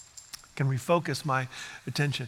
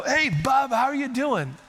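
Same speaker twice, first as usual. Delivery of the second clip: cheerful grandpa greeting; 8 semitones higher, 3 dB louder than usual. Retold or imitated. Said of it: "I'll go".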